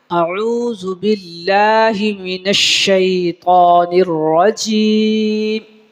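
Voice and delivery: slow, chanted tajweed with long held vowels; Quranic recitation. Shown as a demonstration. Teacher recites for students, slow melodic Quranic chant. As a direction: intone each phrase, sustaining the endings. Recites Arabic prayer verses.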